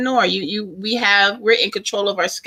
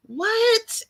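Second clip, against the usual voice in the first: a short, much higher gasp of disbelief; surprised what; 4 dB quieter than usual. Real, not acted. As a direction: pop the word up, amazed. Reacting to it being April.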